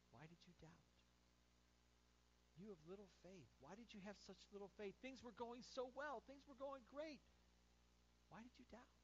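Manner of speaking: soft, hushed, tender asking; softly to Peter on the waves; gentle question. Retold or imitated. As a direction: say it quietly as a friend, not a scold. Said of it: "he just says to Peter, one-on-one".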